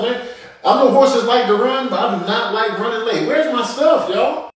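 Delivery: a high, fussy horse voice; frantic horse. Acted. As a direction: search for the cleanser in a rush. Voicing a horse hunting products.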